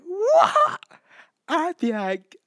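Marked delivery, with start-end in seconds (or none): 0.1-0.8 high, loud shocked squeal; shocked what. Pretend. Shocked squeal at the bald man at her door. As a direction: squeal it in disbelief.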